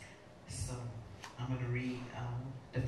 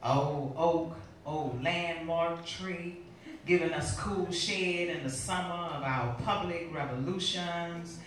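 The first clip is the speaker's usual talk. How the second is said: measured, rhythmic spoken-word reading; deliberate poetry delivery with pauses between phrases; poem recitation; 2 semitones higher, 6 dB louder, slower than usual. Acted. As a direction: recite it as a poem, lingering on the images.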